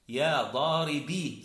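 "ضاربي" held on a long final ee; careful "ضاربي" with no vowel after the ya; silent final ya. Shown as a demonstration. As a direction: sound "ضاربي" as daa-ri-BEE. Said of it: "ساكن يجوز لك".

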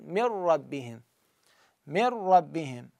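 من ربهم said as مِرَّبِّهِم, نون dropped, ر doubled, slow; tajweed demo. Shown as a demonstration. Teacher reciting slowly for students to copy. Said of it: "فشلون أقراها؟ أقول".

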